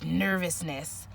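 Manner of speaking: ordinary talk, nothing marked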